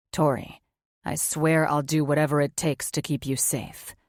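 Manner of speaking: low, subdued male voice; lightly voiced weary man. Male character voice.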